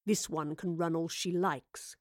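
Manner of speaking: low, slow, quiet menace; cold villain. A narrator's reading. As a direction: close slowly with quiet finality. Villain's cold closing declaration.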